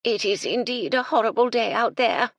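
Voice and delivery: put-on high, loud chirpy old lady; chirped. Lady Belville complaining of the weather, high chirpy. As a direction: twitter it like a dotty dowager. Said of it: "Lady Belville chirped".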